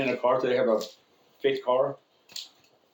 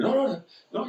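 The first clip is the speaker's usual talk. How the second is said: hasty denial; 11 semitones higher than usual. Retold or imitated. Acts out chaplain's hasty denial.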